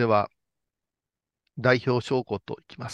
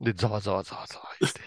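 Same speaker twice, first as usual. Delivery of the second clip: ordinary talk, nothing marked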